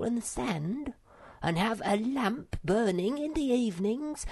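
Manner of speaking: high-pitched small creature voice, dreaming aloud; little animal full of big plans; eager little Sniff. Acted. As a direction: plan happily in a high childlike voice, slowing at the end.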